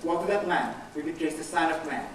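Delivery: solemn quoted Master. Acted. The Lord warns the man who betrays him, solemn and low. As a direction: quote the Lord gravely and quietly. Judas quoting his Lord's warning.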